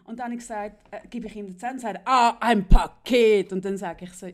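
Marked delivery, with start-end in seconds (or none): 2.0-3.5 a loud, slow, drawn-out mock customs clerk; a loud, slow customs officer, hammed up; mock official. Zollbeamter, gespielt von der Erzählerin. (laut und gedehnt nachgeäffter Zollbeamter)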